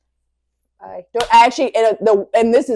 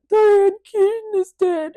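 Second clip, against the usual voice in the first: mock wail; 11 semitones higher, 4 dB louder, slower than usual. Retelling her crying words.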